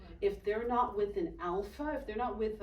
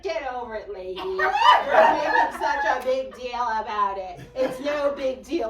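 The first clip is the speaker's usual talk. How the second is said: loud, mocking bratty voice; bratty dismissal; 3 semitones higher, 12 dB louder than usual. Girl telling the lady to get over it, loudly dismissive. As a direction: scoff loudly as an impatient kid.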